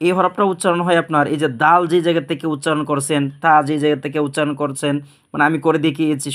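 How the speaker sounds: ordinary delivery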